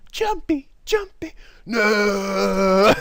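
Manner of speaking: high wailing despair; anguished cry. Acted. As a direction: wail it in mock despair.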